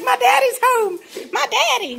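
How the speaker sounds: very high, excited dog voice, cooed; voicing the excited dog. Owner voicing her dog greeting daddy, very high and excited. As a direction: squeal it as the dog overjoyed at her owner's return. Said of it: "She's like".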